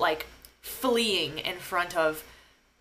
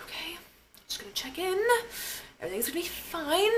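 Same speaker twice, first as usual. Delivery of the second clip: very high, soft, tight self-reassurance; feigned calm; 10 semitones higher, 5 dB quieter than usual. Retold or imitated. Patient reassuring herself at check-in, high small voice. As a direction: squeak it out while pretending to cope.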